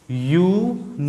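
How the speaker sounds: यूनिवर्सिटी said slowly from the yu sound; slow pronunciation demo. Shown as a demonstration. Teacher modelling यूनिवर्सिटी for the class, slowly. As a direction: let the yu of यूनिवर्सिटी be heard clearly. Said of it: "उच्चारण इसका देखिए".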